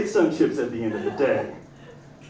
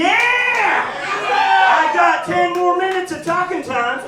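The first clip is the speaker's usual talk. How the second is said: hyped shout; 13 semitones higher, 7 dB louder, slower than usual. Real, not acted. Hyped reply to host.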